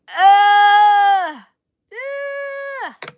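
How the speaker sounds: loud high cringe shout "Ugh"; cringing groan. Retold or imitated. Reacting to the Long Island accent, loud disgusted shout. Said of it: "I'm like".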